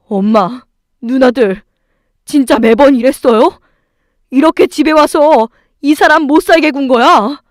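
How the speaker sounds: loud, accusing, building in force; angry shouting. A narrator's reading. Husband confronting mother and sisters, angry shouting. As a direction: confront them, voice rising as you go. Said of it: "남편은 저보다 더 화가 나서 악을 썼습니다".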